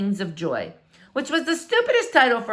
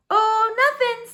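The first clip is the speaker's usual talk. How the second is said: high, slow, too-innocent; girl hiding a squirrel, breezy and innocent; feigned innocence; 13 semitones higher, 4 dB louder, slower than usual. Acted. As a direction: act like nothing's going on.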